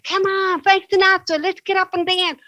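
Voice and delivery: high, excited cheer to dance; rally everyone up like a party host; high-pitched gleeful invitation; excited call. Retold or imitated. Excited dancing with patients.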